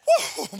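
short, high "woo"; preacher's whoop. Real, not acted. Preacher marvelling at David the anointed king, high whoop. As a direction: let out a quick high whoop.

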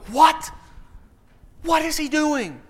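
high, incredulous mock outcry; baffled bystanders' high-pitched exclamation; shocked disbelief. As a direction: play the stunned onlookers, pitch way up. Acting out boatmates' shocked reaction.

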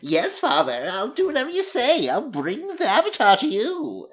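high, swooping dutiful daughter impression; mock her with exaggerated swoops in pitch. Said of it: "Also, her voice".

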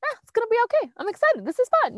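high, light carefree voice; acted breezy excitement. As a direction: toss it off cheerfully, pitch way up. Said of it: "instead of having that, like".

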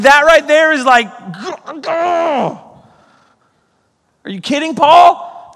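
high, loud disbelieving appeal to Paul; exasperated outcry. Pretend. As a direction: protest in mock disbelief.

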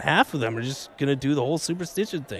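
usual speaking voice

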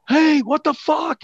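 high, loud, drawn-out holler; retold angry shout. Doorman shouting at kids sneaking past him, outraged. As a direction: imitate yelling after someone running off. Doorman yelling after the runners.

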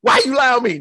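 high, laughing, crying-voiced complaint; sister's whiny accusation. Sister accusing brother of lying, high whiny complaint. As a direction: whine it through laughter like a wronged sister. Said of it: "my sister".

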